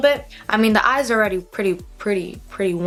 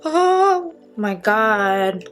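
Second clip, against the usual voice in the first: startled gasp; much slower than usual. Real, not acted. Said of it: "palette almost fell and I almost died".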